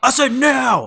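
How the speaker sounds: imitated furious shout, high and loud; yelling. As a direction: mimic the trailer guy yelling his order. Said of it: "he yells at you".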